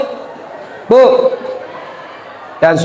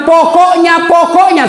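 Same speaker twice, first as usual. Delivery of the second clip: mock stubborn wife digging in; 8 semitones higher, slower than usual. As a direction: pokoknya repeated in a shrill-free, raised insistence. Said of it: "Nabi bilang".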